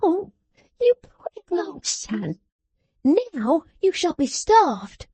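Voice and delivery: high, sharp scolding voice; scolding little woman. A narrator's reading. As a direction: scold him like a child. Jenny mocking father.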